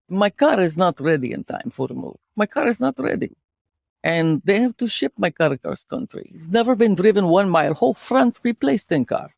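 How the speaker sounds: put-on storyteller with a Russian accent. Host telling co-host about car accident, Russian accent. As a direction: tell a tale of woe in a Russian accent. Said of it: "could you do a Russian accent".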